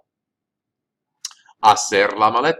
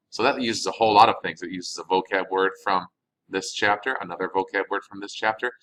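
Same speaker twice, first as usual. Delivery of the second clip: usual speaking voice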